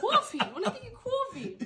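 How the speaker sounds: high, loud Long Island "Coffee" as kwawfee; Long Island accent. Pretend. Interviewer hamming a Long Island accent for the actor, high and laughing. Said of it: "I think that's Long Island".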